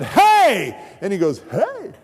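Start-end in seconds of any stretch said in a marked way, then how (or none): 0.0-0.8 loud, high snapped hey; fed-up shout at the door; angry shout. Retold or imitated. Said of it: "I said". 1.5-1.9 very high, sweet little hey; mock-innocent pastor reply; chirpy pastor hey. Pastor cheerfully answers the shout.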